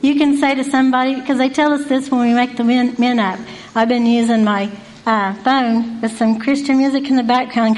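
ordinary talk, nothing marked

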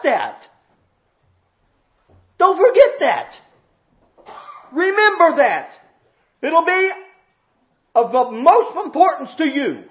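high, insistent, loud preacher's charge; full-force preaching. Real, not acted. Preacher warning those who forgot their purging, full-force preaching. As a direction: hammer each phrase, pausing between them.

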